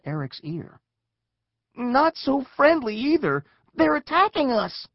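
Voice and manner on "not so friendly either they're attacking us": high, loud cry of alarm; urgent alarm. Acted. Child warning friends of attack, loud high alarm. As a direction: raise the alarm, louder to the end.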